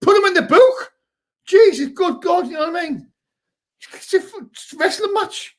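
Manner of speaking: real fan outrage, then trailing off; outraged shout. Real, not acted. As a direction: yell at the referee in disbelief.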